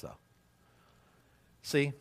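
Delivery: ordinary talk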